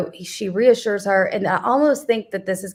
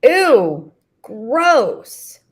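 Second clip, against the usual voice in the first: loud, higher, drawn-out revulsion; hammed-up repulsed daughter; mock disgust; 4 semitones higher, 6 dB louder than usual. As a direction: stretch each word, grossed out.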